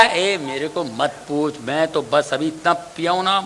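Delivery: slurring husband talking down to his wife; drunk husband. Acted. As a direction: brush her off, full and tipsy. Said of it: "आपने कहा".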